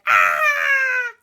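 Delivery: very high, loud, long shriek; shrieking ride scream. Acted. Boy screams on the roller coaster, high shriek. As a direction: scream as on a big drop.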